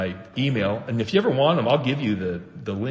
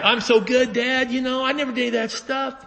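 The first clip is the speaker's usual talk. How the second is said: much higher, smug mock boast with a laugh; self-righteous boasting; 13 semitones higher than usual. Older son boasting to his dad, hammed-up smug. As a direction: ham up a smug goody-goody son. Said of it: "he was self-righteous".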